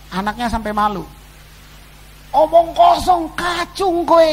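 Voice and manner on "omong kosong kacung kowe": higher, louder jeer; scornful yell at the preacher; mocking shout. Preacher imitating the bitter woman jeering at Pak Arifin, a loud, higher mocking shout. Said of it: "digitu-gituin kalau Pak Arifin lagi khotbah".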